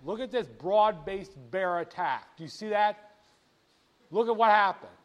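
usual speaking voice

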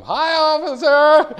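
loud, high, drawn-out hail; cheeky shouted greeting. Acted. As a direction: holler it as you speed past. Driver greets cop while speeding past.

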